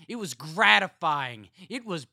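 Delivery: manic relish. A narrator's reading. Carl gloating to his siblings about the murder, manic. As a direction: savour every word of it.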